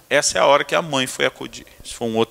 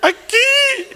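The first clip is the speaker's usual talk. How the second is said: put-on much higher, whiny child voice; little boy; 4 dB louder than usual. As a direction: whimper it like a small boy. (voz de criança bem aguda)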